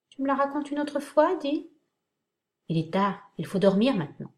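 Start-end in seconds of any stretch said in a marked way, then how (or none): 0.1-1.7 put-on higher, wheedling little-kid begging; playful child voice, much higher; childlike plea. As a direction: beg like a small child at bedtime. Pleading like a child for story.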